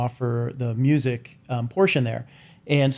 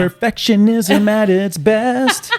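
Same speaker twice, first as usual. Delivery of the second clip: announcer voice; 7 semitones higher, 6 dB louder, much slower than usual. Pretend. Announcing like a slogan.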